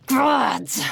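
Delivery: loud, high burst of frustration; exasperated curse. Acted. Master curses over broken vial.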